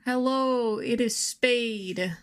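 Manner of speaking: normal talking voice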